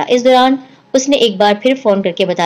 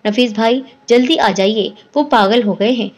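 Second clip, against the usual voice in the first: plain reading voice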